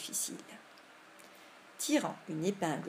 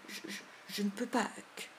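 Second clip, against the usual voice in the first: hushed, stuttering refusal; scared stutter fading to a whisper; frightened stammer; 3 semitones higher, 4 dB quieter than usual. A narrator's reading. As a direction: stammer it out in fear. Too frightened to look.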